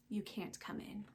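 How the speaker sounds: plain reading voice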